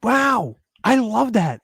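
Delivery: high, loud, thrilled delight; excited exclaiming. Real, not acted. Agreeing with friend on a beloved song, excited exclaiming. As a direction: gush over a shared favorite.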